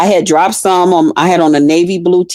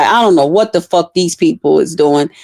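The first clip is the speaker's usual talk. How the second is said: ordinary talk, nothing marked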